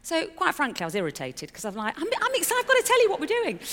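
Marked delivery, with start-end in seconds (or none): 2.0-3.6 much higher and louder, words tumbling out fast; can't hold the news in a second longer; bursting with excitement. Retold or imitated. Wife eager to tell husband the news, excited high rush.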